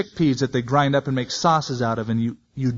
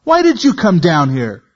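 put-on angry older brother; 6 semitones higher, 4 dB louder than usual. A narrator's reading. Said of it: "Eliab's anger was aroused against David".

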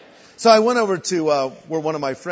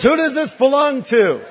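higher, loud demanding call with a laugh; loud question; 7 semitones higher, 6 dB louder than usual. Retold or imitated. Friend's mom confronting the room about the Bible, loud and high. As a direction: demand an answer holding up the Bible. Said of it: "She says".

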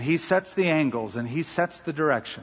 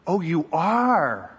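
slow, drawn-out skeptical "oh, you are"; mock surprise; 3 semitones higher, much slower than usual. Teacher doubting the little girl's faith, drawn out. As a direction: sound unconvinced and a bit condescending. Teacher mocks the child's answer.

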